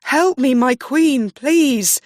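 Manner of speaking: high, loud desperate screams; terrified screaming. A narrator's reading. Riccio begging the queen as they drag him away, screaming. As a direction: beg for your life at full voice. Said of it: "he screamed".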